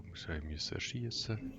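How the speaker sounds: ordinary talk, nothing marked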